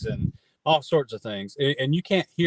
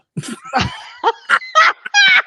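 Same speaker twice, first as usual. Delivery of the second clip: big laugh; 8 dB louder than usual. Real, not acted. Bursts laughing at Velva slip.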